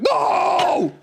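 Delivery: very high hammed-up wail; mock anguished shout. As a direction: scream it in fake horror. Acts the creature refusing cat food.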